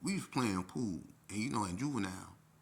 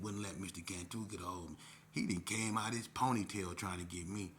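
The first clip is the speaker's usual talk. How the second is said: plain reading voice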